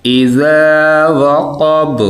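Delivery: slow melodic tajweed chant; quranic recitation. Shown as a demonstration. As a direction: recite slowly, drawing out each vowel. (धीमी सुरीली क़िरात)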